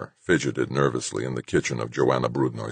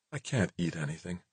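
quiet; 2 semitones higher, 12 dB quieter than usual. Acted. Claire anxious, refusing food.

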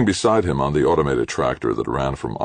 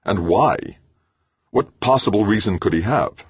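demanding; 7 semitones higher, 5 dB louder than usual. A narrator's reading. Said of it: "McClintock demanded".

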